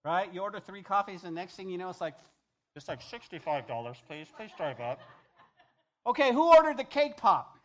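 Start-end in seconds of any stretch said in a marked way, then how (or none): 2.8-5.0 tinny order box voice; like a drive-thru speaker box. Retold or imitated. 6.0-7.6 loud laughing call, higher; barista calling out. Retold or imitated.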